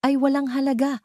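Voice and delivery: usual speaking voice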